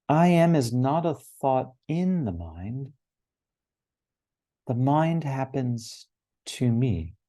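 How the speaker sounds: slow, ringing reading with lifted pitch and long pauses; solemn recitation. Acted. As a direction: proclaim each line of a sacred text, then let it settle. Reads out a spiritual text.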